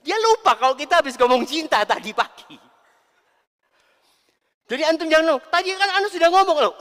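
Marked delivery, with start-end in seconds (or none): 5.5-6.7 fast, much louder higher retort; impatient husband. Retold or imitated. Husband snapping at forgetful wife, fast loud exasperated. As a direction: blurt it out, exasperated.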